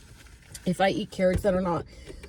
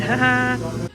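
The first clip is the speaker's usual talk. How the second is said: short, louder laugh; 4 semitones higher, 5 dB louder than usual. Real, not acted. Vlogger admitting the change of plan to viewers, short real laugh. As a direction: laugh it off out loud.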